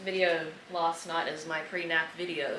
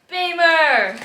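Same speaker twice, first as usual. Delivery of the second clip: excited shout; 10 semitones higher, 14 dB louder than usual. Real, not acted. Excited answer, favourite car brand.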